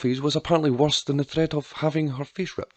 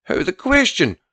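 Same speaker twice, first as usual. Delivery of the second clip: higher-pitched outraged refusal; raging; 7 semitones higher, 3 dB louder than usual. A narrator's reading. Pa refuses his sister taking the child, raging. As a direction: refuse in a rage, pitch jumping up. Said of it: "Pa raged".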